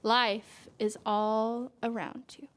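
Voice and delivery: slow, gentle picture-book read; reading to a child. A narrator's reading. Parent reading a flower book to a child, gentle storytelling. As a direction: draw the child into the page.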